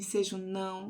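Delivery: ordinary talk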